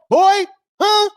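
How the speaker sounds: high, loud taunting call; high loud mocking yelp. Real, not acted. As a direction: throw the taunt at him. Taunting opponent.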